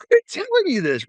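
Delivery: very high-pitched retold insistence; Coy Blevins. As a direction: imitate an exasperated dad insisting. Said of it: "He said".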